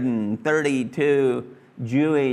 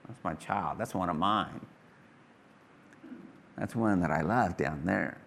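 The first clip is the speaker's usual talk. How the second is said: lower, softer fond father voice; fond murmur; 7 semitones lower, 7 dB quieter than usual. Retold or imitated. God gazing at his child below, lower soft fondness. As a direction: say it like a proud dad, gently.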